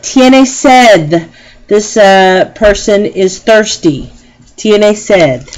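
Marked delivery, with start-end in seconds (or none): 0.0-1.3 slow model phrase. Shown as a demonstration. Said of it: "teacher models slowly".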